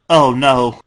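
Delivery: loud horrified cry; horror. A narrator's reading. Dash sees the room and cries out in horror. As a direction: gasp it out in horror. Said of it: "reeled in horror".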